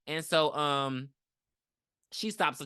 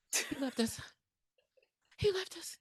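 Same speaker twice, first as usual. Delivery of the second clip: high, hushed little cry; mimicked abandoned kid; 12 semitones higher, 8 dB quieter than usual. Quoting abandoned kids from a movie, hushed and high. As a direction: squeak it softly, like a child left behind.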